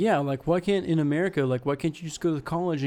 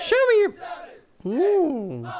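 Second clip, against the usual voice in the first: falsetto; 12 semitones higher, slower than usual. Pretend. Copies falsetto joke.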